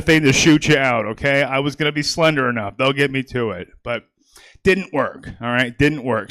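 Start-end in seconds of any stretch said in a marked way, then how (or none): none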